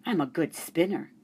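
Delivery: ordinary delivery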